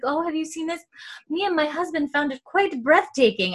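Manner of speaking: high society-lady voice; Louise. Retold or imitated. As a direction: gush in a put-on high voice. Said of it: "and she's like".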